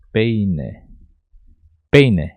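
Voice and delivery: "peine" as PAY-neh, stretched first, then firm and falling; pronunciation demo. Shown as a demonstration. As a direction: model "peine" with a clear final E. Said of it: "desta maneira". ("peine" com E final bem pronunciado)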